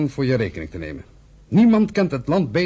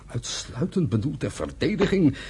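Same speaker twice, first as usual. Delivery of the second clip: slow, softer disbelieving echo; incredulous echo; 4 dB quieter than usual. Acted. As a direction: repeat his words as if they're absurd. Echoing brother's words in disbelief.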